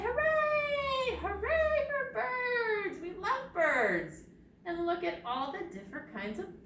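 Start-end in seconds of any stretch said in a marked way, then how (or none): 0.0-4.2 high, loud cheering shout; excited cheer. Shown as a demonstration. Librarian leads the children in a cheer, excited high shout. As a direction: cheer it out for the kids. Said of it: "Everybody say".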